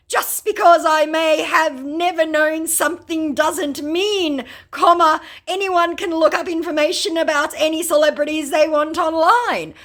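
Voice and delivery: high, loud mock scammer rant. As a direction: squeal the clumsy defence. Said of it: "my scammer said".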